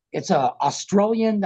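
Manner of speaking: ordinary talk, nothing marked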